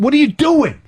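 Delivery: retelling the shock of seeing the hole; alarmed outcry. Retold or imitated. To the tech guy, retelling his horrified outcry. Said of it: "And I said".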